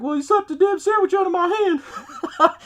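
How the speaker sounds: high laughing mock whine about the sandwich; can barely get the words out for laughing; cracking up mid-quote; laughing through it. Absurd diner fight retold.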